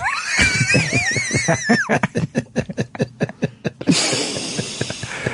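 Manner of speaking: long, very high laughing fit; real high-pitched cackling laugh; helpless laughter. Real, not acted. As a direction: laugh until the breath runs out. Cracks up at comic.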